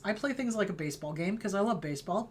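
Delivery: usual speaking voice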